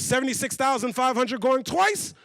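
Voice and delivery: ringing call with a rising finish; auctioneer. Acted. Auctioneer getting no answer, insistent rising call. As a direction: hold the room in suspense as auctioneer. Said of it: "The auctioneer's gotta keep the process going".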